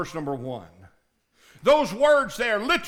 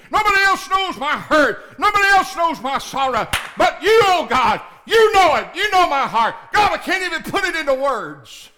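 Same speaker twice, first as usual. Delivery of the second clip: much higher and louder crying out, sinking to a hush at the end; desperate prayer; 9 semitones higher, 9 dB louder than usual. Acted. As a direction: beg God as the only help left. Acted desperate prayer to God.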